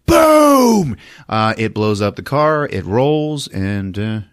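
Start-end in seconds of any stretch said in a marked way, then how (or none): 0.1-1.0 loud, high boom; big explosive imitation; explosion noise. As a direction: shout the blast as a sound effect. Mimics explosion.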